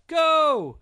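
high, very loud starting yell. A narrator's reading. Daddy sending the runner off, full yell. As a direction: let it rip to launch the race. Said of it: "Daddy yelled".

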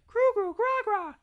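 put-on very high, loud baby babble; babbling baby. A narrator's reading. Baby Maggie copying her family, high loud babbling. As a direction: squeal out nonsense syllables like a baby. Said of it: "And Maggie said".

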